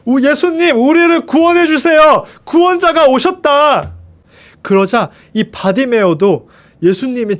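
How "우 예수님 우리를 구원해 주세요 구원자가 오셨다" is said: crowd calling out. A narrator's reading. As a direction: call out to the savior passing by. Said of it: "사람들이 외쳤어요".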